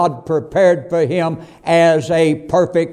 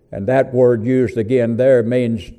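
ordinary talk

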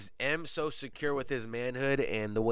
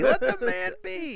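high mock voice; 5 dB louder than usual. Friends egging on the voice.

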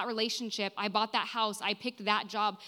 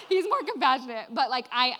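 teary laugh; 9 semitones higher, 3 dB louder than usual. Real, not acted. Laughs through tears after crying.

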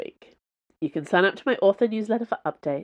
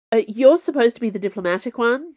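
sputtering; 6 semitones higher, 5 dB louder than usual. A narrator's reading. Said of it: "Jules sputtered".

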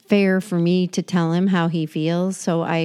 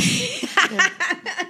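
high giggle at a burp; high laugh; 13 semitones higher, 3 dB quieter than usual. Real, not acted.

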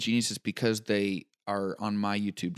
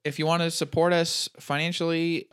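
ordinary delivery

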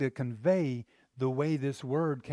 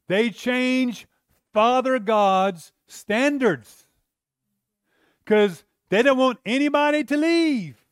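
loud, driving sermon voice, pressing each point hard; full-force preaching; 7 semitones higher, 12 dB louder than usual. Acted. Pastor warning the church about lowering standards, loud preaching.

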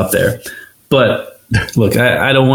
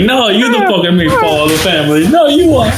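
hammed-up whiny squabble, pitched higher and rushed; mock sibling bickering; 8 semitones higher, faster than usual. Podcaster mocking the brothers' TV squabble, hammed-up high bickering. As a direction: play a petty brother snapping back, fast and high. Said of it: "being like".

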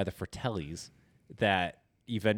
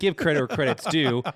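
loud, high, insistent retort; emphatic vindication; 8 semitones higher, 8 dB louder than usual. Retold or imitated. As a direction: make the point loudly, half laughing. Retold argument, demanding song credit.

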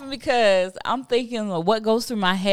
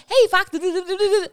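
high, loud sing-song teasing; mock chatty partner; 11 semitones higher, 4 dB louder, faster than usual. Mocking her man's early-morning chatter, high sing-song.

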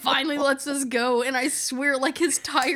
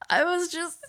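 breaks into high helpless laughter; can't get through the line for laughing; laughing fit; 12 semitones higher than usual. Real, not acted. Telling a friend the car wash story, laughing hard.

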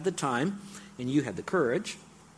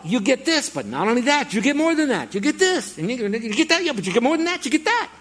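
much higher and louder, bubbling over; pretty excited; 10 semitones higher, 7 dB louder than usual. Real, not acted. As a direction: pile it on like you can't contain yourself. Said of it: "I know I'm pretty excited".

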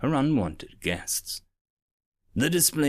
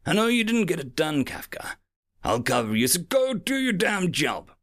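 urgent command; 7 semitones higher than usual. A narrator's reading. Captain ordering Kafka.

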